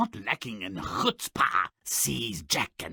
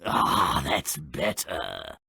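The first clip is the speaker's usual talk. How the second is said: ordinary talk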